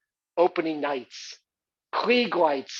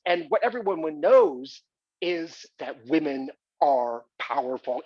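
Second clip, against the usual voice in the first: ordinary delivery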